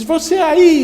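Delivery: high, loud call; shouted hail. Acted. Boy hails the hidden child, shouting. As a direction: holler out to someone far off.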